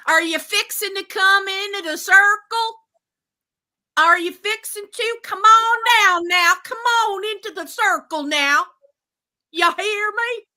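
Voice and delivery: hollering people into the circle, big and high; loud Southern preacher calling. Retold or imitated. Mocking a leader calling the group in, loud and high. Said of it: "be like".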